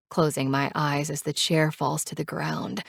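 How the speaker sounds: usual speaking voice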